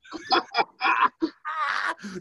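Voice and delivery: cackling laugh; cracking up hard; real laughing fit; high burst of laughter. Real, not acted. Laughing at knockout punchline.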